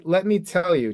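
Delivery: ordinary delivery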